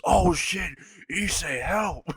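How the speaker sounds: high, sudden mock panic; panicked cry for help. Venom being chucked, calls to Issei in high mock panic. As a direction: yelp it like you're being thrown. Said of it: "and Venom says".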